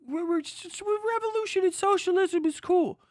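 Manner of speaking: high, sneering impression of a smug leftist; mocking lefty voice. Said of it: "they're like".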